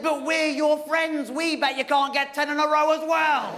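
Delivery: high, slow teasing plea; mock wheedling; coaxing friends. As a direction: egg him on. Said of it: "And they said".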